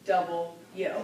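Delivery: "Double U" said crisply as two words; clear double u. Shown as a demonstration. Teacher repeats the letter clearly for students. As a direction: carefully articulate "Double U". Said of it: "This letter is two words".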